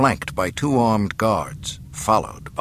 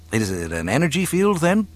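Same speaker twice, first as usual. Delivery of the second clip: quick, higher curious question; inquiring pupil; 8 semitones higher, faster than usual. A narrator's reading. Pupil asking his mentor about the Force, quick curiosity. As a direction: ask it eagerly, wanting to understand. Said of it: "Luke inquired".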